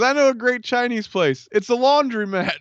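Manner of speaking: hammed-up sitcom drunk, pitched high; mock character voice. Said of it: "He goes".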